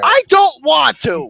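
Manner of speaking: loud refusal. Real, not acted. Arguing about seeing movie.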